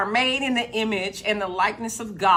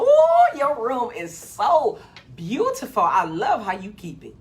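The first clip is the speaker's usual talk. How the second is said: mock sweet praise.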